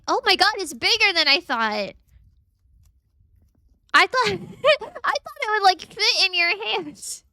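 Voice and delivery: be stunned how big it is; surprised. Real, not acted. Seeing the hyrax's size, amazed.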